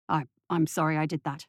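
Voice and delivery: low, hesitant father's voice; stammered apology. A narrator's reading. Father apologising to the boy in the nightshirt, low stammer. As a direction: stumble lightly into a low apology. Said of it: "stammered Denton".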